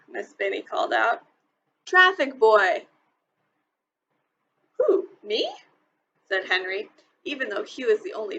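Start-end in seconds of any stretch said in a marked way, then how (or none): 1.9-2.9 slow, loud call; teacher calling the traffic boy again. A narrator's reading. 4.8-5.5 surprised. A narrator's reading. Boy surprised at being called.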